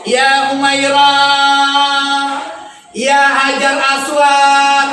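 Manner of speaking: loud, long drawn-out endearment; affectionate calling. Retold or imitated. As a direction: call out a pet name, stretching it slowly. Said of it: "memanggilmu dengan panggilan-panggilan mesra". (panggilan mesra yang dipanjangkan)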